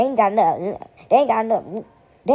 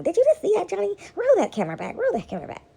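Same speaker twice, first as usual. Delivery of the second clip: mock startled host; 11 semitones higher than usual. Mock TV host calls to cameraman.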